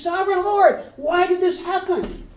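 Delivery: high, loud desperate plea; anguished Joshua. Retold or imitated. Joshua pleading with God after defeat, high and anguished. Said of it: "And he says".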